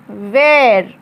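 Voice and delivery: वेयर said as "wair", falling; pronouncing wear. Shown as a demonstration. Teacher correcting the spelling-based reading, वेयर as "wair". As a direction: say वेयर as "wair", not "weer". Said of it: "लेकिन यहाँ इसका उच्चारण है वेयर".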